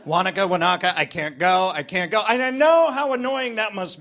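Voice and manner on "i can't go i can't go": ordinary talk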